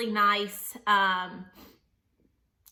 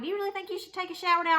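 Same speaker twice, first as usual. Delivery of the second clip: mom's worried question; 8 semitones higher, much faster than usual. Retold or imitated. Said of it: "Mom was like".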